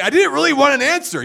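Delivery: high, loud, rattled outburst; freaked-out blurt. Retold or imitated. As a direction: blurt it out, half laughing, thrown off. Retold freaked-out reply to God.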